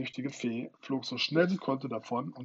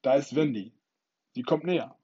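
loud, raised call; bellowing; 3 semitones higher, 8 dB louder than usual. A narrator's reading. A boy spotting Wendy, bellowing. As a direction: shout the warning. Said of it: "brüllte einer der Knaben".

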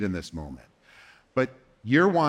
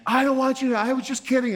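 put-on much higher, rushed panicky teen; panicked backpedal; 11 semitones higher, faster than usual. Teen panicking when counselor says she must report, backpedaling. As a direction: scramble to take it back.

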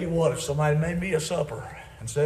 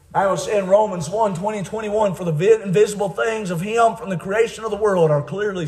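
loud, raised preaching voice; forceful scripture preaching; 6 semitones higher, 8 dB louder than usual. Real, not acted. Preacher citing Romans to the congregation, forceful preaching. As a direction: drive the verse out loudly.

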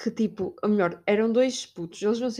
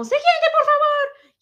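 high, loud and slow mock call of a gate agent; hammed-up yelling gate agent; mock shouting agent; 8 dB louder, much slower than usual. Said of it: "eles já estavam".

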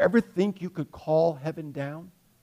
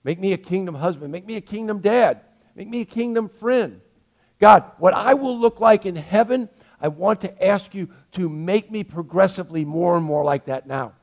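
model prayer; 3 semitones higher, 6 dB louder than usual. Shown as a demonstration. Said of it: "We should be saying".

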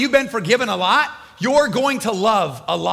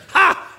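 scoffing laugh; 10 semitones higher, 5 dB louder than usual. Acted. Pharisee scoffing at Jesus.

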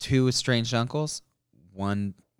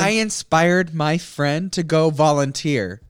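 slow, louder, higher mock pride; smug mock boast; 5 semitones higher, 7 dB louder, much slower than usual. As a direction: drag it out like a proud little saint. Mocking a smug gift-giver.

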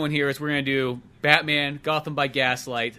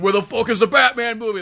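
loud, high fake-angry demand; mock demanding; 8 semitones higher, 6 dB louder than usual. Fan storming store for Batman movie, mock angry. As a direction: bark at the store clerk.

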